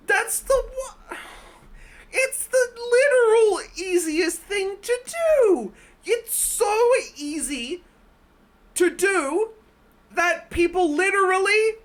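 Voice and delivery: high, slow, loud exasperated rant. Real, not acted. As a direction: spell out how easy it is, fed up. Ranting at bad jump scare.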